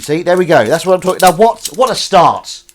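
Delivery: loud, high celebration of the quip; excited cheer. Real, not acted. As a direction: whoop it up like a goal just went in. Cheers first quip on the stream.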